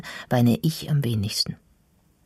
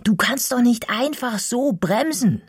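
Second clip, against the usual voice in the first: much higher, scolding shout; indignant yell; 11 semitones higher than usual. A narrator's reading. Girl scolding the boy who braked suddenly, indignant yell. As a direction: tell him off in a high-pitched yell. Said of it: "jemanden anzuschreien".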